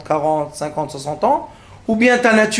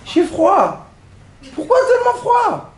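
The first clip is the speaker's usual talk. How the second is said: mock whiny passenger, pitched much higher; complaining passenger; 11 semitones higher than usual. A plane passenger complaining to the flight attendant, whiny and high. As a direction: imitate a fussy lady moaning about the cold, voice climbing. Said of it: "Oui, madame, qu'est-ce qui se passe?".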